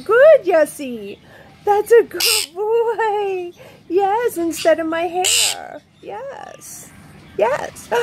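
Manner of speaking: slow, high, drawn-out praise for a pet bird. Real, not acted. Owner praising her parrot for ripping the tissue, slow high pet talk.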